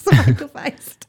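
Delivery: low, slow words through a laugh; chuckled, drawn-out echo; amused laughing. Real, not acted. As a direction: laugh while teasing the guest. Host amused by guest's hedged answer.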